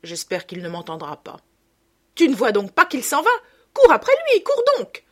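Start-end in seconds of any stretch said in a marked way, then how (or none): 2.1-5.0 high, loud urgent cry; frantic shout. A narrator's reading. Mother shouting at her son to chase the waiter. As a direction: yell at him to run.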